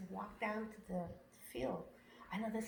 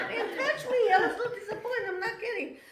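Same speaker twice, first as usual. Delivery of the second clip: laughing; 11 semitones higher, 9 dB louder than usual. Real, not acted. Laughing mock disappointment.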